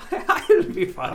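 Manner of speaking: very high, slow, laughing mock Arabic accent on "I'll be fine". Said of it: "Your Arabic accent".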